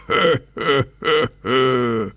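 wind's booming storybook laugh; North Wind laugh. Acted. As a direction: laugh in four big puffs as the North Wind. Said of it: "lachte der Nordwind".

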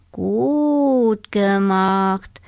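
very slow snail. Acted. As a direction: drag each word like a snail. The snail praises. (sehr langsame Schneckenstimme)

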